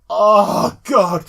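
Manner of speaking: high, very loud ecstatic groan; thrilled outcry. Retold or imitated. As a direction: cry out, overwhelmed by how awesome it is. Retold thrilled reaction to the gameplay.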